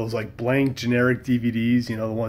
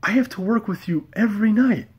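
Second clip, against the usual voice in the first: high exasperated; 9 semitones higher than usual. Retold or imitated. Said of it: "And I was just like".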